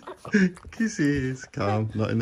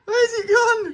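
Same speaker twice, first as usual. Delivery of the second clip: laughing; 10 semitones higher, 8 dB louder, faster than usual. Real, not acted. Playing hide and seek with dog.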